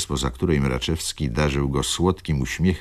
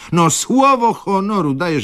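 high, louder, "słowo" punched; excited protest; 5 dB louder than usual. Acted. Young clerk swears to colleagues, high and eager. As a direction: swear on your honour eagerly. Mraczewski protesting his honour.

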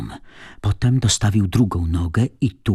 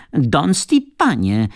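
high, drawn-out call that rises; 6 semitones higher, slower than usual. A narrator's reading. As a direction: keep calling the name, rising at the end. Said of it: "krzyczała Tatiana".

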